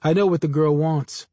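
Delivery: ordinary delivery, nothing marked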